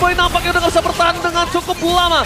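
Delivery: loud hype play-by-play call; fighting game announcer. Acted. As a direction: shout the action like an esports caster. Caster hypes teamfight play-by-play.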